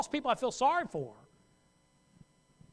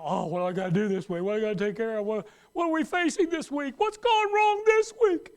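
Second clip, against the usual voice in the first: mock fretting building; 4 dB louder than usual. Worrier's inner voice building.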